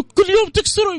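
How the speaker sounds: very high shocked question; astonished question. Retold or imitated. Anchor retold asking the artist, very high squeal. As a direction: squeal it in disbelief.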